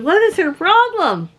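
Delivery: high, indignant question; baffled and offended. Retold or imitated. Husband baffled by the rude lady, indignant. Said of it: "Bruce said".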